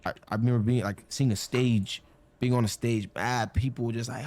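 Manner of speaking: ordinary talk, nothing marked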